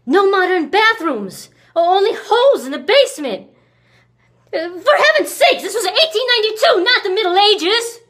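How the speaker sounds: loud, high-pitched outburst; shrieking exasperation at the indignity; exasperated rant. Acted. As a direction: rail in full indignation. Ranting about primitive house.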